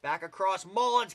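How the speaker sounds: brisk, rising basketball call; live play-by-play. Acted. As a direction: call the game as it unfolds.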